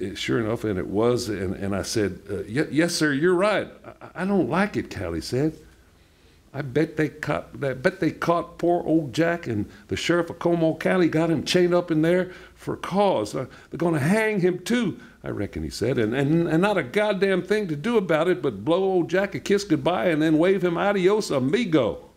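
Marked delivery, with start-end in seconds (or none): 2.4-4.9 higher, lightly voiced cowboy; cowboy Callie. Callie warns Papa, lightly voiced cowboy. 6.5-22.1 higher, lightly voiced cowboy; cowboy Callie. Callie warns Papa, lightly voiced cowboy.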